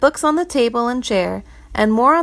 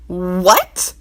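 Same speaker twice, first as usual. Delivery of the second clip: short roaring What, louder, in a gruff voice; 4 dB louder than usual. Acted. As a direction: bellow it out in disbelief. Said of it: "roared the treasurer".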